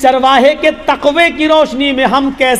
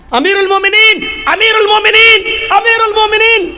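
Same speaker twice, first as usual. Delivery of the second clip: calling out; 6 semitones higher than usual. Acted. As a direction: call his master from far off. Slave calling out to Umar. (Ghulam کا اپنے آقا کو دور سے زور سے پکارنا)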